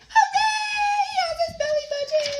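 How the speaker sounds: high, fast and joyful; cheering hooray. A narrator's reading. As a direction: cheer the happy ending. Happy ending cheer.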